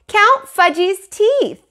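put-on high, slow toddler demand; little kid voice. Fudge insists to the dentist, high slow toddler voice. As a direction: drag it out like a small child. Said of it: "he said".

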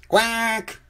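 high, squawky duck call; duck quack. A narrator's reading. Narrator voices the duck.

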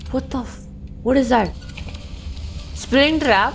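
high, alarmed yelp of surprise; startled outburst. Real, not acted. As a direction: blurt it out in shock. Shocked by trap.

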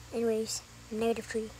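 low, soft monotone; name yourself low and evenly; put-on character voice. Child back in Negative Three role, low. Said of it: "this is just a good voice impression".

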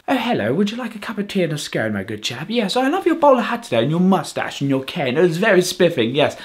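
hammed-up posh English gent; posh English accent. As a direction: caricature a posh English accent, pitch swooping. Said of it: "You mean the posh English accent".

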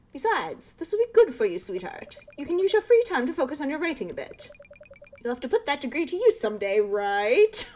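a touch of a nagging mother pleading; pleading mom. Acted. Mom's dialogue voiced.

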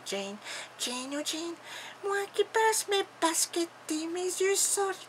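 put-on soft, high English-accented impersonation; faint, high, repetitive murmur with an English accent; Jane. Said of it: "Charlotte, sors de ce corps de Jane". (voix douce et aiguë, accent anglais)

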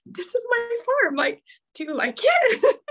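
high-pitched, giggly eager asking; eager show-off. Retold or imitated. Showing friends her farm at a cookout, eager and giggly. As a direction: beg for approval with a nervous laugh. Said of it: "I'm like".

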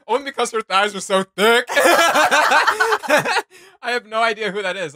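high, loud words pushed through laughter; real laughter, slow and breaking up; laughing while talking. Real, not acted. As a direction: laugh your way through the guess. Laughing while guessing characters.

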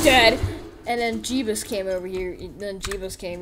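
ordinary delivery

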